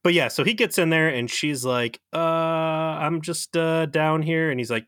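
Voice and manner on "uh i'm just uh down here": high, drawn-out stalling; mock sheepish excuse, pitched up; caught out. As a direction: stall awkwardly on a long, higher "uh". Acts her caught snooping, stalling.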